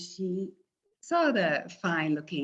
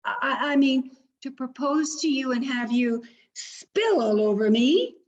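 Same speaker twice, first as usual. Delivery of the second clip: high, loud angry shriek; shrieking suitor; 5 semitones higher, 4 dB louder than usual. Acted. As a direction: screech at her in outrage. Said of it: "he shrieked at her".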